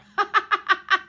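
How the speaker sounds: a high burst of laughter at her own joke; laughing at own pun. Real, not acted. Host laughing at her own card pun, high bright laugh.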